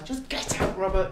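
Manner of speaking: a tight, breathy, angry hiss; hissed order. Acted. Wife throwing her fiancé out, hissing in anger. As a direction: spit it through your teeth.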